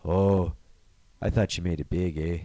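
low, halting disappointment; let-down border officer. Retold or imitated. Officer learning the star still lives in Canada, low letdown. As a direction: drop the pitch and pause after the first word.

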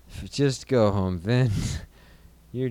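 normal talking voice

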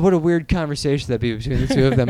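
ordinary delivery

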